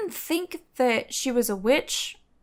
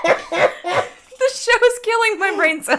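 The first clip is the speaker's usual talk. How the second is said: laughing; 9 semitones higher, 6 dB louder, slower than usual. Real, not acted. Laughing after failed joke.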